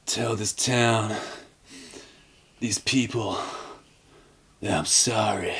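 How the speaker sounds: slow, soft, solemn dying words; imitating a hushed farewell. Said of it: "and he does say it like this".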